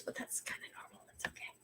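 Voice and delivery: hushed unvoiced aside; whisper. Real, not acted. Whispered aside to viewers about hugging strangers. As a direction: whisper it like a secret.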